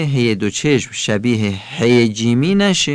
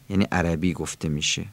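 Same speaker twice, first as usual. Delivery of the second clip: ordinary delivery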